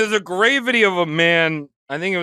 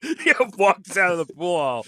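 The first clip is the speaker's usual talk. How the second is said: amused, laughing as the words come out. Real, not acted. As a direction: talk through a laugh. Cracking up at the story.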